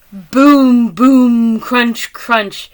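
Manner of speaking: stomp the words like monster steps; big storybook stomps; booming footsteps. Acted. Reading monster footsteps aloud.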